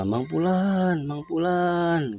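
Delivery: high, slow drawn-out call. Acted. Rich man calling slowly into the Barhut well, high. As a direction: stretch the name into the dark well.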